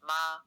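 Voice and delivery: steady, flat-pitched "Ma"; Mandarin first tone. Shown as a demonstration. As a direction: keep "Ma" even, above speaking pitch. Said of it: "The word for mother is".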